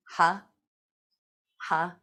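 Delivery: strong ha breaths; laughter exhale. Shown as a demonstration. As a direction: breathe out ha with the group. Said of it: "Let's try it together".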